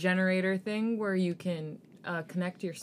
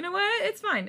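high-pitched; 12 semitones higher, 4 dB louder than usual. Real, not acted. Talking herself into acceptance.

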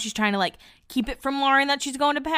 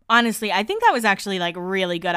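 usual speaking voice

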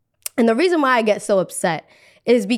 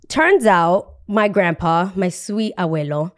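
ordinary delivery

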